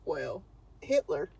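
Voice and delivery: ordinary delivery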